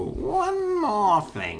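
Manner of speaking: high, very slow catchphrase impression; Columbo. Asking how often the detective said it, drawn-out imitation. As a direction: draw it out like a hammed-up detective. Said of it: "did Columbo go".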